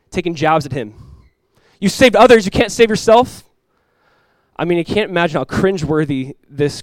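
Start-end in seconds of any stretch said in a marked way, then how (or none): 1.8-3.4 high, loud taunting question; mockery. Crowd taking jabs at Jesus, mocking. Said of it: "Imagine the mockery".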